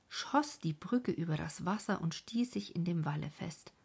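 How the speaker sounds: plain reading voice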